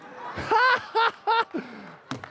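high, gleeful laugh; delighted laugh. Real, not acted. Preacher laughing at Haman's downfall, high glee. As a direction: laugh at the irony.

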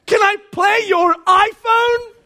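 high, loud, slow exaggerated shout; dad repeating his daughter's question as a shout; shouted mock repeat. Shown as a demonstration. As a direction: belt it out in disbelief. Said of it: "repeated for effect".

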